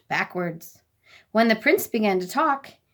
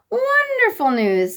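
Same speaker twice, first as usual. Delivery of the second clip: much higher, loud, slow glad cry; delighted; 12 semitones higher, 6 dB louder than usual. A narrator's reading. Noble lords hearing King Tub's reply, high and thrilled. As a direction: exclaim with joy. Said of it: "said the noble lords".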